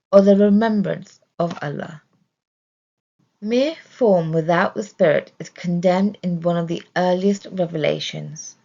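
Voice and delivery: ordinary talk, nothing marked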